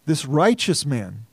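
ordinary talk

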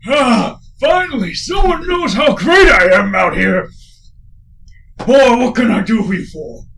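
put-on loud, high proud old man; boastful old trainer. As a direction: crow about your own greatness, delighted. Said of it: "it goes".

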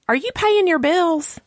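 high-pitched, challenging retold question; pointed question. Friend challenging her money panic, pointed.

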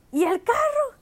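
a mother demanding answers; mom's demand. Retold or imitated. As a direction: mom asking where the car is. Mom returning, asking about car.

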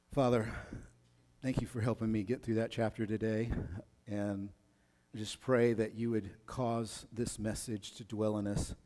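soft, low prayer voice. Acted. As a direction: pray quietly and humbly. Opens a prayer.

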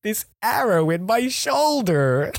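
slow, high, loud hammed-up reporter; news reporter voice. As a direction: drag it out like a dramatic live report. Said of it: "he wants to be a news reporter".

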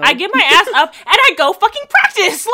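high, loud exasperated rant; exasperated shout. Real, not acted. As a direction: yell it out in frustration. Ranting about practicing.

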